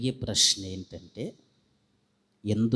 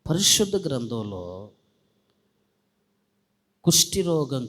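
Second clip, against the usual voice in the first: fervent preaching; 3 semitones higher, 6 dB louder than usual. Acted. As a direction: preach it out to the congregation with fire. Preacher rises to full force. (ఉద్వేగంతో బిగ్గరగా ప్రసంగం)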